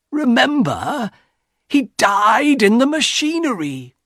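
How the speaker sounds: high, anguished reminder; horrified warning. A narrator's reading. Husband reminds wife how son died, horrified. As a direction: warn her with mounting horror.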